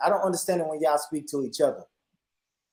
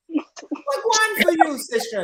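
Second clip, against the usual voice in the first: squeaky slow Jamaican patois; high, drawn-out patois greeting; high-pitched patois; 3 dB louder, slower than usual. Retold or imitated. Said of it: "my patois is, high pitched".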